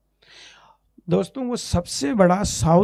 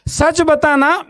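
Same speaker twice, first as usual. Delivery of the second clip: much higher pleading ask; pressing question; 9 semitones higher, much slower than usual. Retold or imitated. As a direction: urge him to tell the truth. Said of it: "उसका गुस्सा खत्म". (ऊँची आवाज़ में ज़ोर देकर पूछना)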